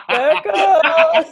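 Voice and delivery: high laughing shout of a name. Real, not acted. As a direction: call her out through laughter. Laughing calling out friend.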